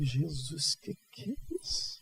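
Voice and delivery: whispered amazement, soft and unvoiced; breathless awe. Young seminarian opening the gift box, whispered amazement. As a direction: breathe it out in wonder.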